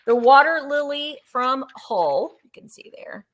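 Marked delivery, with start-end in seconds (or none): 2.4-3.3 breathy whispered aside. Real, not acted. Seller whispering to viewers about the vase. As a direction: drop to a whisper for a secret.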